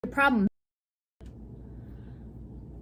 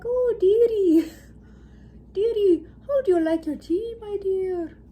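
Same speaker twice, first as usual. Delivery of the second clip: put-on doting old woman; 7 semitones higher, 5 dB louder than usual. Isra offering tea to Alara, doting old woman voice. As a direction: fuss over her like a sweet grandma. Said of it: "this old woman who was so nice and kind".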